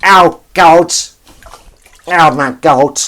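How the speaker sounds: loud, high camp wail; camp impression. Imitating the impersonator's camp cry, loud and high. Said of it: "That sounded a bit Alan Carr".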